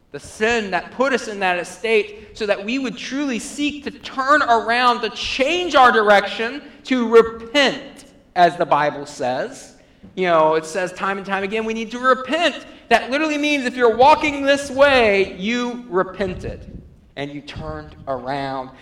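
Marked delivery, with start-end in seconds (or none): 0.1-8.1 raised, loud call to repentance; high, forceful exhortation; urgent preaching. Real, not acted. 10.1-16.8 raised, loud call to repentance; high, forceful exhortation; urgent preaching. Real, not acted.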